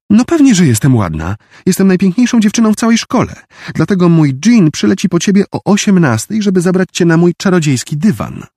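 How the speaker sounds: high, fast, boastful schoolgirl; vain girl voice. Acted. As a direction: brag it, full of herself. (próżna dziewczyna, bardzo wysoko)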